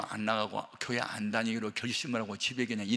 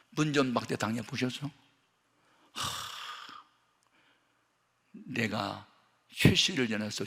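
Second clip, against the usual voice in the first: ordinary talk, nothing marked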